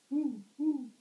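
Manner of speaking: high, loud hooting; owl hoot. Acted. Parent reads to toddler, hooting like the owl. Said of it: "asked the owl".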